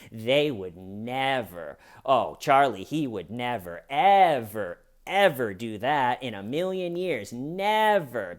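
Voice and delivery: "never" and "ever" stretched, each pushed a new way; mock emphatic never. Mocking Wendy's denials, leaning on "never". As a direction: lean hard on every "never", varying the tune. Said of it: "change the inflection in the word never or ever".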